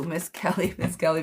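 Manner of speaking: ordinary talk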